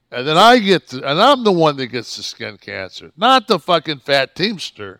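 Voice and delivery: loud, high aggrieved outburst; heated complaint, voice jumping up; indignant rant. Real, not acted. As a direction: burst out at the unfairness. Indignant rant about his own burden.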